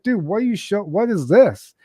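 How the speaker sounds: high, urgent, horrified questioning; shocked demand. Retold or imitated. Asking the man who walked him in what this film is, in shocked disbelief.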